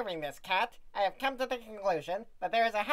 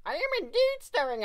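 pitched-up cheeky aside; high stirring interruption; 9 semitones higher, 4 dB louder than usual. Acted. Mouse butting into the narrator, high and cheeky. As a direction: pop in high and bold.

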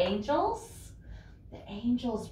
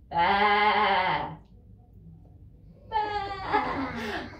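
sheep bleat; 2 semitones lower than usual. Shown as a demonstration. Imitating a sheep.

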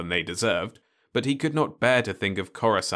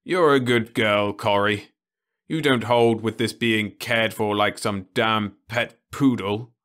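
louder gruff affection; fond old man; 3 semitones higher, 6 dB louder than usual. Acted. Osmond pats Corrie's hand, gruff and fond. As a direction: praise her, grumble at them.

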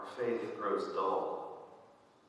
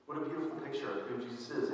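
ordinary delivery

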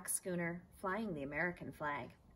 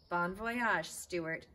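louder holler; 6 dB louder, much slower than usual. Acted. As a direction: holler a farewell across the pond. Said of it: "hollered his friend".

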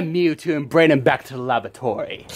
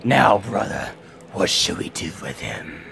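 low villain voice; 8 semitones lower, 4 dB quieter than usual. Acted. Villain in lower voice.

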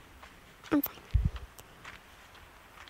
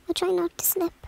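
usual speaking voice

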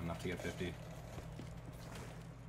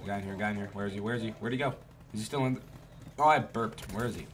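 usual speaking voice

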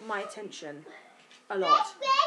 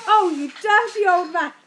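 much louder, higher amused scolding; mock-tell off a cheeky old man; teasing laugh; 7 semitones higher, 12 dB louder than usual. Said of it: "sat there and went".

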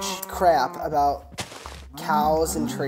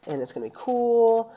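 high, eager mock self-talk; quoting her own cheerful plan, lightly performed; upbeat inner voice; 8 semitones higher, 6 dB louder than usual. Gamer retelling her own plan, in a high eager voice. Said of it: "and then I'm like".

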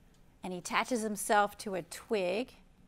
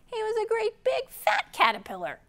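much higher, louder, booming; big and fat; 13 semitones higher, 8 dB louder than usual. Acted. Reading the climax to children, higher and louder. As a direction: puff the words up big and fat.

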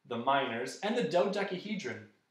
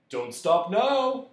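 loud, higher, drawn-out urging; insistent; 5 semitones higher, 8 dB louder, much slower than usual. A narrator's reading. Magician pressing his guests to keep eating, insistent. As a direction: urge them on loudly, stretching it out. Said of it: "he insisted".

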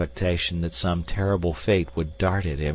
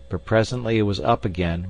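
usual speaking voice

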